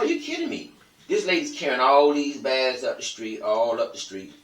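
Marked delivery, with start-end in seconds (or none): 0.0-0.7 can't believe he said no; retold disbelief. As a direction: pitch jumps way up, incredulous. Shocked the boy won't help.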